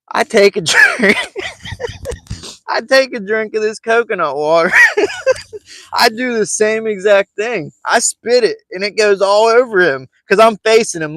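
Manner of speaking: barely holding back laughter; lightly laughing storyteller; laughing through it. Real, not acted. Laughing while telling.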